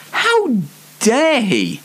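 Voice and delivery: hammed-up indignant gasp, pitch leaping up; mock-shocked how dare he; mock outrage. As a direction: play offended, big rise on How.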